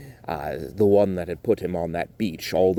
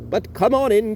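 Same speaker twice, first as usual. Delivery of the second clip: guard's voice; 13 semitones higher, 4 dB louder than usual. Acted. Guard welcomes them in.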